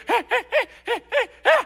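high ape calls building up; sit and chatter like an ape getting agitated; agitated monkey. Retold or imitated. Agitated ape on the shore, high building calls. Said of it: "he goes".

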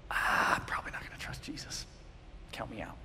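a churchgoer admitting no trust in Jesus; whispered doubt. Pretend. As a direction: admit it under your breath.

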